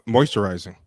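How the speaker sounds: ordinary delivery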